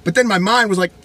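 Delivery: ordinary talk, nothing marked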